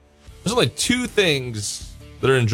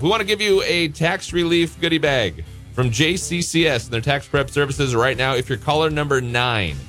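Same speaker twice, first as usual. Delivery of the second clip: upbeat DJ contest pitch; radio contest promo; 5 semitones higher than usual. Acted. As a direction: sell the call-in giveaway.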